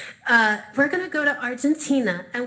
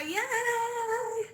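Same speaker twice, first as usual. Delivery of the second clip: high cheer, softer; 10 semitones higher, 6 dB quieter than usual. Real, not acted. Instructor cheering her class after the dance, high cheer.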